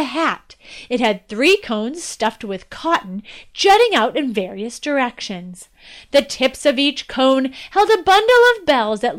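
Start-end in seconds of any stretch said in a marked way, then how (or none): none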